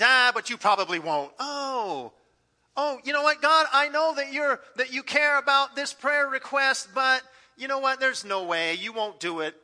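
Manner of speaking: doubting pray-er. Retold or imitated.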